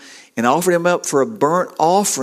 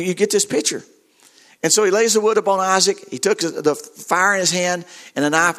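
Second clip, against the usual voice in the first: ordinary talk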